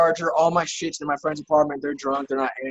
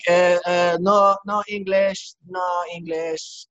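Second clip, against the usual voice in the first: put-on slow, broken English in a Swedish accent; Swedish-style foreign accent; 5 semitones higher, much slower than usual. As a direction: play dumb in a hammy Swedish accent. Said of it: "I just started speaking Swedish".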